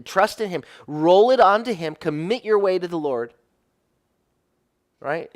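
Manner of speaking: fervent preaching crescendo; preaching at full force. Acted. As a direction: press the promise home like a preacher. Preaching builds to a climax.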